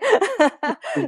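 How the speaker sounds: a loud, high giggle; high laugh. Real, not acted. Guest laughing at her outgoing teenage self, giggle. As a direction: let the laugh spill out. Laughing about not being shy.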